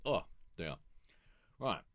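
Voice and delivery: normal talking voice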